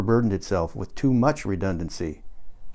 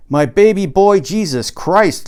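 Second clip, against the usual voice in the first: much higher and much louder exclamation, slowing on Jesus Christ; astonished outburst; 8 semitones higher, 7 dB louder, slower than usual. Acted. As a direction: burst out in shocked disbelief. Lauren erupts reading the article.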